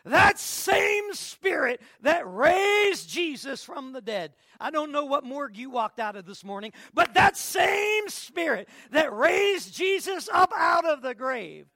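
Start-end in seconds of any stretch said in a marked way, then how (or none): 0.0-4.3 slow, loud, high preacher's cry; fiery preaching. Acted. Preaching at full force. 6.9-11.7 slow, loud, high preacher's cry; fiery preaching. Acted. Preaching at full force.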